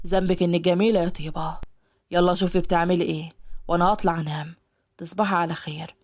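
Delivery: low male voice, lightly flirtatious; low teasing man. Acted. Ashhab compliments the maid, low and teasing. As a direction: compliment her and say goodnight.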